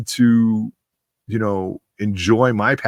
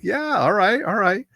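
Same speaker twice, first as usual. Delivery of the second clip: high, pitched-up eager agreement; eager reply; 11 semitones higher than usual. Retold or imitated. Accepting the host's invitation to join live, high eager voice. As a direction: jump at the offer with a high eager yes.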